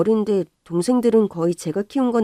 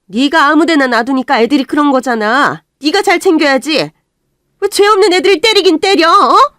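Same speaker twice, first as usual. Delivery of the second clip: loud, high furious scolding; furious shout; 8 semitones higher, 10 dB louder than usual. Acted. Mother shouting at her daughter for hitting her brothers, furious.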